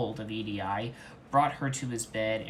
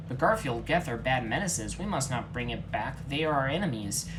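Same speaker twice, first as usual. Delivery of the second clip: ordinary delivery, nothing marked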